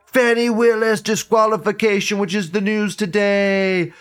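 news anchor voice. Acted. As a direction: read it like breaking news. Announcing headlines like newsreader.

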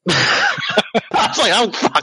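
high, laughing through the words; real laughter breaking into speech. Real, not acted. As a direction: crack up while owning up to a blunder. Laughing at own mishap.

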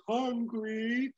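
high-pitched weird villain cry; weird baddie. Film fan quoting a weird baddie, high mock villain cry. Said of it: "she's a weird baddie thing, and she goes".

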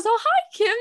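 high, bright TV-host greeting; sing-song broadcaster hi. Retold or imitated. Imitating the host's chirpy greeting to a fan. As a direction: pitch it way up on the name. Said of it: "professional voice going".

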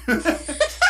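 loud, high burst of laughter; amused laugh. Real, not acted. Laughing at own rest stop story, loud amused laugh. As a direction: crack up at your own story.

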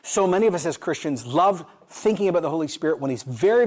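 ordinary delivery

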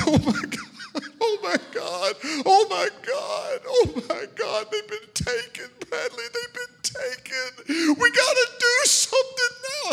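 high, loud and tearful panic; panicked crying. Retold or imitated. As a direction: sob out the panic. Wife panics, kids taken.

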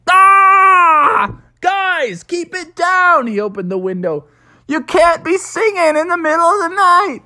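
high, loud scolding yell; dad shouting at the pumpkins to hush. Acted. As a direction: yell out the window at noisy pumpkins. Dad yells out the window.

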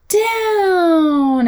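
"down" drawn out from high, gliding downward; stressed DOWN. Shown as a demonstration. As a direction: make "down" a long falling slide. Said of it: "I really stress that down".

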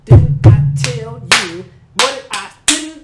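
loud, high, slow syllabic scolding; high slow hammered-out scolding; mom's scolding. Retold or imitated. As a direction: beat each syllable like a scolding mother. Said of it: "while you get".

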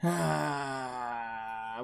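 low, soft drawn-out sigh; long sigh. Real, not acted. Weighing up a rating, a long sigh. Deliberating before giving a rating.

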